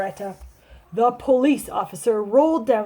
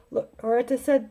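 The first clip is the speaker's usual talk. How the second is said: usual speaking voice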